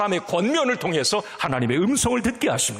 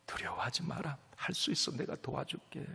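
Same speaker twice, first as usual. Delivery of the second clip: gentle whisper; 11 dB quieter than usual. Acted. God speaking softly.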